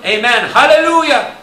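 high, loud amen and hallelujah; soaring amen, hallelujah; exultant cry. Acted. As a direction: cry out in worship. Voicing the elders' worship.

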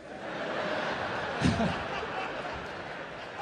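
long breathy laugh at own joke. Real, not acted. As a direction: laugh off the dark punchline. Comic laughs at own bit.

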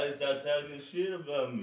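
off-mic and distant; turned away from mic. Real, not acted. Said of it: "Yeah, into the mic".

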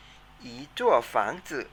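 อีจั่วฝังจื่อ said slowly and loud, syllable by syllable; slow teacher model of อีจั่วฝังจื่อ; model pronunciation. Shown as a demonstration. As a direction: spell out อีจั่วฝังจื่อ slowly for students to copy. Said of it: "ออกเสียงตามนะครับ".

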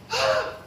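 mock gasp of surprise; sudden acted-out gasp; startled gasp. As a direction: imitate someone gasping in shock. Cousin's startled reaction acted out.

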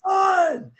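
full-volume high yell across the park. Retold or imitated. Coach yelling at runners to sprint to the finish, a high loud shout. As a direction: shout it as loud as you can. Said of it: "So I'm, like, yelling at him".

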